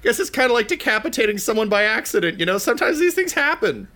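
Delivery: high, chatty character voice; breezy poop smith. Acted. Poop Lord excusing a killing to allies, high and breezy. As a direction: prattle on high, shrugging it off.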